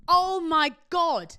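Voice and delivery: shocked. Real, not acted. Shocked at seeing crack smoked.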